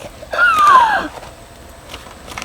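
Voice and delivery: loud, high, long gasp; exaggerated mime's gasp; acted gasp. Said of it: "he's like".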